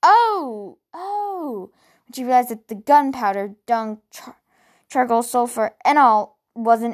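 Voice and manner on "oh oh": Oh! jumping up high in surprise; a startled, dawning cry that falls away; sudden realization. A narrator's reading. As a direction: gasp of sudden understanding. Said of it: "she had an, an".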